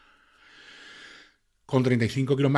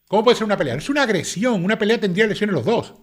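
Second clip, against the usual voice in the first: higher and louder, exasperated protest; indignant outburst; 8 semitones higher, 5 dB louder than usual. Real, not acted. Commentator to viewers, indignant raised protest. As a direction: push back hard, pitch up in outrage. Arguing against defense claim.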